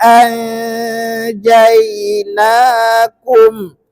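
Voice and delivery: slow, drawn-out melodic tajwid chanting; Quran recitation. Acted. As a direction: chant each phrase slowly for the class to repeat. Recitation for repeating.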